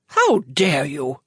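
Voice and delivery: low, slow snarl; a hint of a snarling rival. A narrator's reading. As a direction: spit it out like an insulted dealer. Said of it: "snarled the well-dressed, mustached man".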